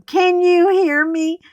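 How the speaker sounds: loud, high mock shout; mimicked yell. Mimicking the girl yelling at her, loud and high. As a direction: yell it up high like she did. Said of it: "She starts yelling".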